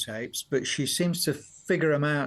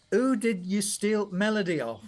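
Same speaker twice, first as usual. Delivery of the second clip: higher-pitched imitation of Yorkshire dad; Yorkshire accent; 5 semitones higher than usual. Father doubting son wrote the melody, imitated Yorkshire accent. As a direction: accuse like a broad Yorkshire father. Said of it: "Yorkshire accent".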